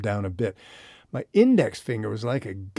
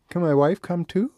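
thin voice; 6 semitones higher, 4 dB louder than usual. Retold or imitated. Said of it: "In a thin voice I asked".

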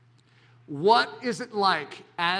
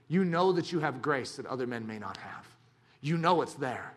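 normal talking voice

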